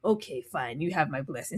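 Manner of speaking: low, soft reluctant blessing; grudging king. Retold or imitated.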